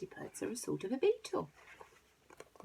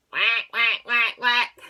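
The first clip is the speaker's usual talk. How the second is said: loud, higher repeated duck quacks; quacking; 4 semitones higher, 12 dB louder, slower than usual. A narrator's reading. Dog tricks the robbers, loud repeated quacking. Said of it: "the dainty dog began to".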